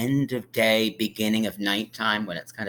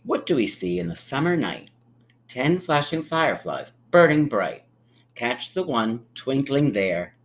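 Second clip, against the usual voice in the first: sing-song picture-book read; gentle storybook narration; reading to children; 4 semitones higher, 3 dB louder than usual. A narrator's reading. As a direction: read it like storytime for little ones.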